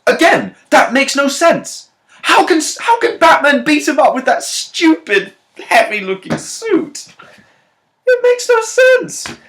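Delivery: very high, loud falsetto outrage; hammed-up high-pitched rant; exasperated squeal. Real, not acted. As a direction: shriek in disbelief, stretching the words. Exasperated rant at the film's logic.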